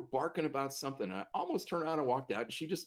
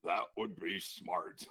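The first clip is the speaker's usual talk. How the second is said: low, slow, dry reply; gravelly patient's slow understatement; deep growly voice; 6 semitones lower, much slower than usual. Retold or imitated. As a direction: rumble it out deep and drawn-out. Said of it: "deep growly voice".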